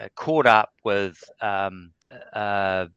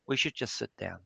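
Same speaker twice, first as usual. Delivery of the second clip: put-on calm old man; 10 dB quieter, faster than usual.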